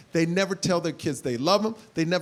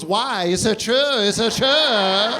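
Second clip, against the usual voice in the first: taunting mom; 5 dB louder, much slower than usual. Retold or imitated. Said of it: "She goes".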